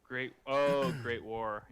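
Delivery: usual speaking voice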